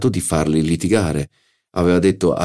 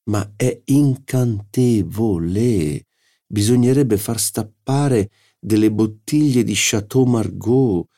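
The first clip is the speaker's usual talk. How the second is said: a hint of an enraptured painter, slow and savouring; a gourmand savouring the dessert aloud; lightly voiced Schie; 6 semitones higher, slower than usual.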